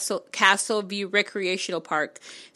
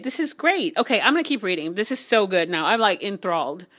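ordinary talk, nothing marked

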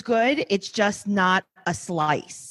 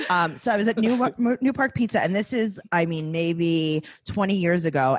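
normal talking voice

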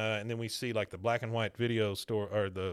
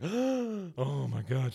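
shocked Peggy; 3 semitones higher, much slower than usual. Retold or imitated. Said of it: "Peggy's just like".

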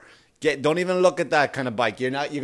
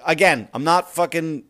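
normal talking voice

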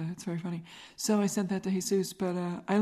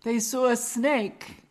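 deliberate, slow delivery of SAW A SNAKE; slow, drawn-out words; 4 semitones higher, 3 dB louder, much slower than usual. Shown as a demonstration. Host repeating herself to show her accent, slow and deliberate. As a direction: stretch out THEY SAW A SNAKE, higher. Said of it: "I have an accent".

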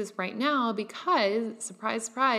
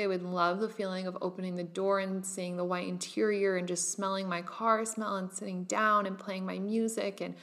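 ordinary talk

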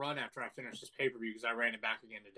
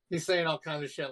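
usual speaking voice

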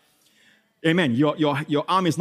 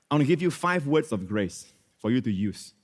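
ordinary talk, nothing marked

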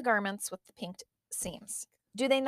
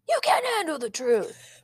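movie quote; 4 semitones higher than usual. Retold or imitated. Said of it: "That was Jack Nicholson".

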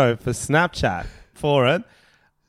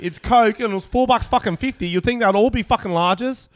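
ranting; 9 semitones higher than usual. Real, not acted. Venting to his co-host about restaurant service, high heated rant. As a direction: vent about the tiny glass, worked up.

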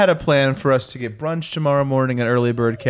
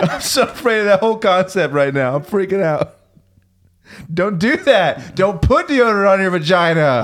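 hammed-up panic; 7 semitones higher than usual. Mock panic over deodorant joke.

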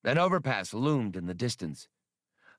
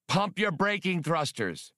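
higher barked command; shouted order; 7 semitones higher than usual. A narrator's reading. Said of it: "He shouted to Chewbacca".

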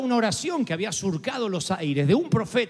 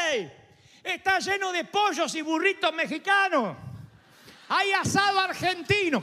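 mock shout from outside; 9 semitones higher than usual. Lepers shouting to the city gates, a playful high mock shout. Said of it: "gritan de afuera y dicen".

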